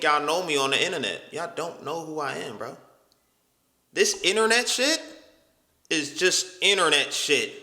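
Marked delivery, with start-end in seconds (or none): none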